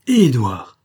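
loud, startled exclamation; surprised cry. A narrator's reading. Denisot sees the colonel and cries out in loud surprise. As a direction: burst out at the sight of him. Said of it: "s'écria-t-il".

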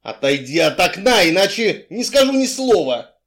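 loud, rising counter-demand; heated, forceful threat; shouted ultimatum. A narrator's reading. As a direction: shout back, climbing in pitch.

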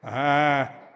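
ordinary talk, nothing marked

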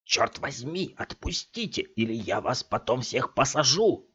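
high, blustering threats; raging. A narrator's reading. Scott rages at the thugs, hiding his panic. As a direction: hide despair behind fury. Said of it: "бушевал Скотт".

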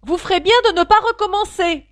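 avertissement crié, aigu et fort; lance-le comme une menace; cri d'avertissement. Acted. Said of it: "Puis elle cria". (Alice crie aux animaux de cesser, forte et aiguë)

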